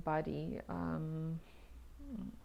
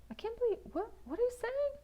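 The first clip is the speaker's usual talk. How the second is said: incredulous inner voice; 14 semitones higher, faster than usual. Retold or imitated. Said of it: "And I'm thinking".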